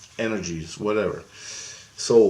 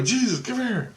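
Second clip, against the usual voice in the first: high beckoning call; 13 semitones higher than usual. Retold or imitated. Dreamer calls Jesus over for the girl, high call. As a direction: beckon in a high voice without raising volume.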